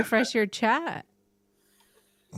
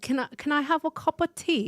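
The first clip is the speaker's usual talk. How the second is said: put-on British accent; 7 semitones higher than usual. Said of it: "Can you do your best British accent".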